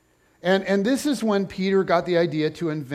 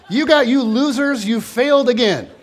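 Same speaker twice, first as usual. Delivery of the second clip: laughing taunt; 5 semitones higher, 6 dB louder than usual. Acted. Jesus teasing disciples, laughing.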